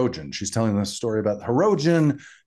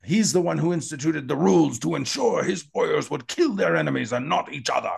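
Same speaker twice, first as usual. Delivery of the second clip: hammed-up Klingon elder's proclamation, pitched up; Bob O'Reilly; 4 semitones higher than usual. Host voices Kolar defending the emperor's rules, declamatory. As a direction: declaim it grandly with raised pitch. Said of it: "You sound like Bob O'Reilly right now".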